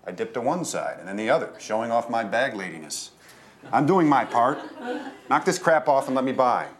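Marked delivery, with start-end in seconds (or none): 3.7-6.7 put-on high, louder old lady; bag lady voice. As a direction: demand it like a cranky old woman.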